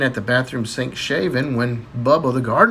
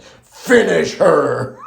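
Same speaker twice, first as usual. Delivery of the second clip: drawn-out game announcer command; very slow, mock-epic announcer voice; Mortal Kombat; 4 dB louder, much slower than usual. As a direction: boom it out like a fight-game announcer. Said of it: "in my best Mortal Kombat voice".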